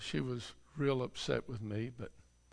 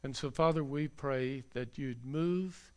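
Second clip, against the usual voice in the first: closing prayer. Acted. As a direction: pray it aloud for the congregation. Pastor leads closing prayer.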